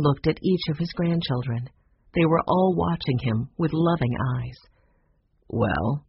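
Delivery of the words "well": low, measured grandfather voice; reluctant low "well", unhurried; slow reply. A narrator's reading. Grandfather answers his pleading grandchildren, slow and low. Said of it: "he said slowly".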